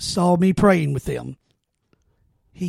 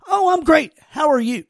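higher, sunny answer nobody gave; imagined cheerful reply; 7 semitones higher than usual. Retold or imitated. The reply he hoped coworkers would give, upbeat. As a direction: lift it up, bright and glad. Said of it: "not one said".

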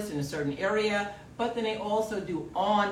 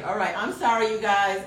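usual speaking voice

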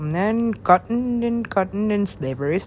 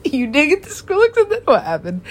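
louder, laughing through the line; 9 semitones higher, 7 dB louder than usual. Retold or imitated. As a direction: crack up while repeating it.

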